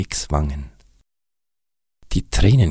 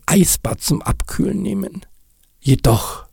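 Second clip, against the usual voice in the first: leicht höhere, naive Kinderstimme; Nick, kindlicher Schneemann; 8 semitones higher than usual. Acted. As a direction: spiel den arglosen kleinen Schneemann. Nick's character voice. (Nick erklärt Kass arglos)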